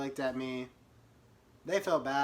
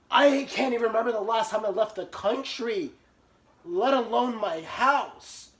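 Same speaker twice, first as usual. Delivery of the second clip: higher, louder indignant complaint; indignant rant; 8 semitones higher, 5 dB louder than usual. Retold or imitated. Venting at the accusation, indignant and loud.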